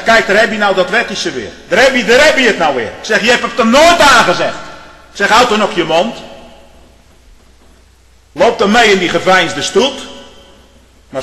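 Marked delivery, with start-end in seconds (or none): 0.0-10.1 raised voice rebuking a minister; firm, loud pulpit scolding; retold heated rebuke.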